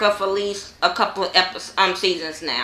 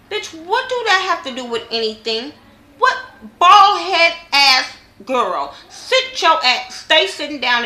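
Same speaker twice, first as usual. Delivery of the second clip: angry rant; 6 semitones higher than usual. Real, not acted. Vlogger venting at a reality star, angry and high. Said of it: "Sorry I was a little bit angry".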